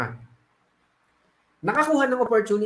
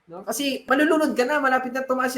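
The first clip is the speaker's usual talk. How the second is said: calling out; 4 semitones higher than usual. Acted. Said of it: "sinisigawan si Bogart".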